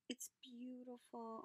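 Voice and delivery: soft slow whisper; awed whisper. Real, not acted. Admiring Gail's gift, soft awed whisper. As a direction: whisper it like a secret.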